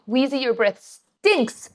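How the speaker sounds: high, slow, drawn-out scold at the dog; mock scolding dog. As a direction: tell the dog off in a high, stretched voice. Scolding the dog for bad breath.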